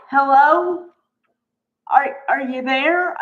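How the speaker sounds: mock calling out to someone not paying attention; exasperated. Exasperated retort to the TV character.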